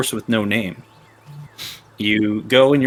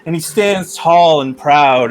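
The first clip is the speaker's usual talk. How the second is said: slow, higher, epic storyteller voice; grand narration; 7 semitones higher, 4 dB louder, slower than usual. A narrator's reading. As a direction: paint the majestic horse. Majestic horse description.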